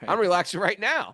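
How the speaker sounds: loud, high comic exclamation; mock protest. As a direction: burst out with the punchline. Sage's punchline to Alexander.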